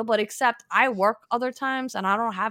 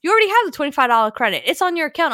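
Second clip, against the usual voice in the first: higher, louder, fast chirpy agent; bright agent voice; 5 semitones higher, 5 dB louder, faster than usual. Retold or imitated. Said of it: "she goes like".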